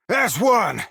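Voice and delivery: rally the crew to fight; battle shout. Acted.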